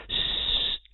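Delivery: unvoiced, breathy sst; a quick startled hush. A narrator's reading. Mr Leonard hushing Paul, a whispered sst.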